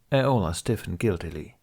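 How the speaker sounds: ordinary delivery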